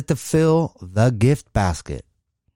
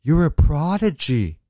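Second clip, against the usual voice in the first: awed passerby; slower than usual. Acted. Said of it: "a passerby is".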